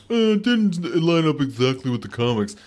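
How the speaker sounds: nasal mocking fanboy whine; mock a nitpicky fan; whiny nerd voice. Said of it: "I get so tired of people going".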